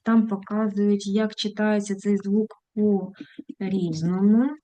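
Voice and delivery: ordinary talk, nothing marked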